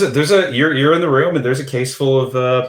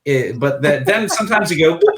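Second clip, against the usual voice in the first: ordinary talk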